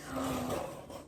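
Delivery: soft, breathy gasp of awe. Real, not acted. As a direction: gasp at a beautiful surprise. Reacting to the book cover.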